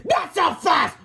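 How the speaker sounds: high, commanding shout. A narrator's reading. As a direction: shout to stop everyone in their tracks. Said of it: "shouted Melvin Sneely".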